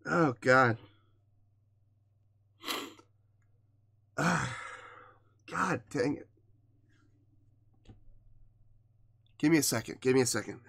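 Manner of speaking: very soft, halting, sighing; hushed and overcome, long heavy pauses; choked up. Real, not acted. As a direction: fight back real tears, barely getting the words out. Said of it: "I'm getting choked up".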